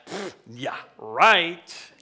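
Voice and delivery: dismissive puff, then a loud, drawn "right"; scoff, then punch out a sarcastic "right"; scoffing disbelief. Acted. Listeners reacting to Moses's brave speech, scoffing in disbelief. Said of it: "you'd be like".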